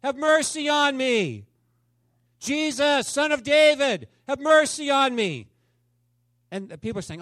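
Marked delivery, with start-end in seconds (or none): 0.0-1.5 high, loud, drawn-out plea; pleading shout with long held words; crying out. Acted. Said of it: "he cried out". 2.4-5.5 high, loud, drawn-out plea; pleading shout with long held words; crying out. Acted. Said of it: "he cried out".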